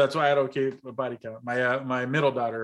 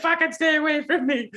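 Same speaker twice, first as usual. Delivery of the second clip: much higher, laughing mock snarl; mock furious sister; 13 semitones higher than usual. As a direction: hiss it like an outraged little sister, half laughing. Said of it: "she was just like".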